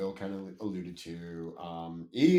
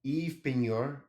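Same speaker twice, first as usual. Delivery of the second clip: attempted French name; 5 semitones higher, 4 dB louder, slower than usual. Shown as a demonstration. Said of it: "not saying that with a good French accent".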